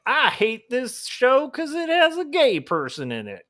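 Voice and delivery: high, whiny caricature of outrage; hammed-up, high-pitched griping; mock conservative complainer. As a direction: imitate an indignant culture-war ranter. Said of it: "weird conservative".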